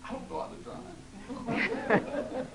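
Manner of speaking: slow, breathy laughing line; slow laughing dismay; laughing realization. Acted. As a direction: giggle it out slowly. Old woman realizes.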